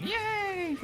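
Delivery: high happy yay; cheering. Real, not acted. Enemy destroyed.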